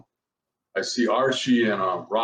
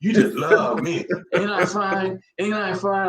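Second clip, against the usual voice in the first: higher-pitched mock come-on; 8 semitones higher than usual. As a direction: mimic a tipsy woman pestering him. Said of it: "she's going off on me".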